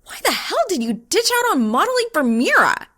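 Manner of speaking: high, loud furious demand; confronting her roommate, furious and loud; angry shout. Acted. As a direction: yell at the roommate.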